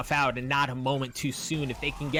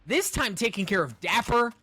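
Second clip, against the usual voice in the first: excited; 8 semitones higher than usual. Real, not acted. Caster still hyped after play.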